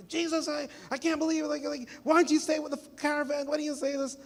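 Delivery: high, lightly voiced scolding mother; a mother scolding her lost boy; mother scolding young Jesus, high exasperated scolding. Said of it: "how that story went".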